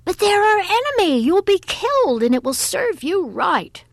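shrilled, high and loud outburst; very high, loud scolding cry; shrill troll. A narrator's reading. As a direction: shriek it like an outraged mountain troll.

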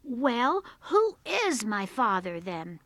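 loud, demanding question; cross child demanding an answer; angry boy. A narrator's reading. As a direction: confront mother crossly. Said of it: "angry to his mother".

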